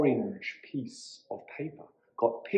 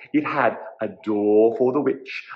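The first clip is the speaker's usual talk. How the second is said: ordinary delivery, nothing marked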